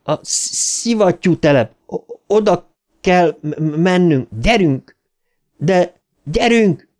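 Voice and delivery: urgent stammer. A narrator's reading. Bill urges the gang to run, stammering. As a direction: drive them on through the stutter.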